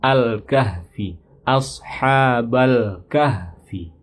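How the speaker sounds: "ashabal kahfi" slow, the l stopped clearly before k; sukun on the lam. Shown as a demonstration. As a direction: close the l of "al-kahfi" cleanly. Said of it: "dibaca sukun ya". (lam sukun pada "al-kahfi" dibaca jelas)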